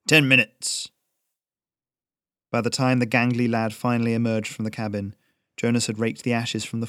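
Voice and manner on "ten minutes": firm, louder command; stern old smith. A narrator's reading. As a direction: set the deadline, no arguing. Old smith orders the boy.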